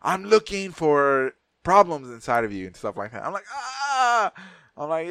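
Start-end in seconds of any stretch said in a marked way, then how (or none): 0.0-2.7 slow, deliberate, drawn-out announcement; mock probing technician. Sonogram lady digging into his gut, slow and deliberate. 3.5-4.3 long high "ah" of acted pain; mock pained shriek. Patient jabbed by the sonogram probe, mock shriek. Cries out as probe stabs him.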